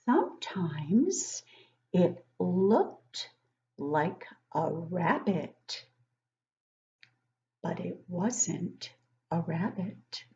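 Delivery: slow, drawn-out storybook reading; reading to children. Acted. Teacher reading a picture book to young kids, slow and storybook-like. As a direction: read it slowly to the class, savouring each line.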